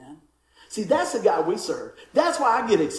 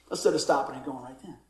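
ordinary talk, nothing marked

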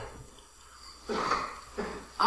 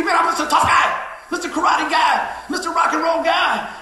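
loud, high swaggering tough-guy boast; mock macho bragging, shouted; boastful tough guy; 7 semitones higher, 6 dB louder than usual. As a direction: puff up like a proud tough guy. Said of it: "I used to be".

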